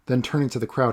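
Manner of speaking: plain reading voice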